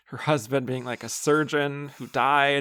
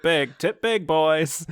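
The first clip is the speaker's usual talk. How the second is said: higher, punchy imitation of advice; mock exhortation; 5 semitones higher, 5 dB louder, slower than usual. Woman babysitting preteen boys lectures them on tipping, imitated emphatically. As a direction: hammer it home to the kids, pitched up. Imitating the heroine's diner monologue.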